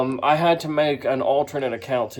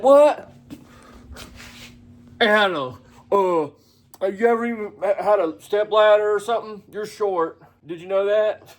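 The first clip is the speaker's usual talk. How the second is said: high, exaggerated mocking voice of the rival; mocking impression; 8 semitones higher than usual. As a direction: ham it up to make him sound silly.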